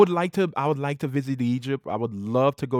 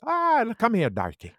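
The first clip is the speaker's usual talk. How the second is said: mock Irish; 10 semitones higher than usual. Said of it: "And then you're like".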